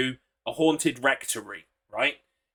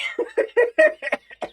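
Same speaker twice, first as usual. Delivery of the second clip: high cackling laugh; 3 dB louder than usual. Real, not acted. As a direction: burst into a high helpless cackle. Laughing mid-sentence.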